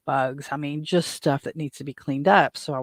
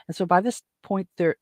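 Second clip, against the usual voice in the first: usual speaking voice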